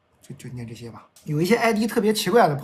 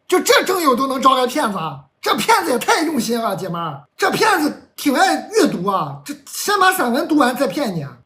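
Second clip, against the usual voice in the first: high, loud comic disbelief; ranting at a listener over a wordy personal ad, voice pitched up; incredulous outburst; 7 semitones higher, 5 dB louder than usual. Real, not acted. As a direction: exclaim in exasperated amazement. Mock disbelief at flowery personal ad.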